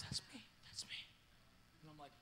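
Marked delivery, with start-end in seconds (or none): 0.0-1.0 imitating a weeping man, whispered; tearful whisper. Man in the crowd owns the picture, tearful whisper. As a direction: whisper it as if overcome. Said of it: "starts to weep".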